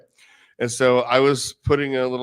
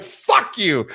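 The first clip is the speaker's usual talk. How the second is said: laughing mock insult; 10 semitones higher, 7 dB louder than usual. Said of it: "I'm like".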